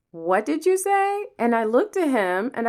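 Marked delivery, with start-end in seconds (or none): 0.1-1.3 high, slow, affronted "What did you say?"; indignant boss. Retold or imitated. Coworker challenging her after "whatever", slow and high. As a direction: draw it out like an offended superior. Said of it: "he was like".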